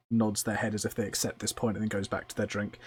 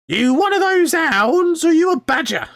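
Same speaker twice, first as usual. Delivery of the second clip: slow, very high, loud goblin holler; shouted high creature voice; drunken goblin; 15 dB louder than usual. Acted. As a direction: interrogate strangers as a suspicious goblin. Goblin challenges the newcomers.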